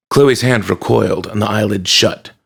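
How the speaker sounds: ordinary delivery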